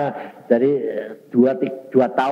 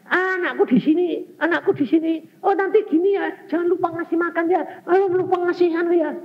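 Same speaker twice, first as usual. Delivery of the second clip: high, fussing mother's voice, clearly raised in pitch; doting mother voice; 4 dB louder than usual. Retold or imitated. Preacher mimics a clingy mother fussing over her son, high and doting. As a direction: coo over the child like an anxious mum.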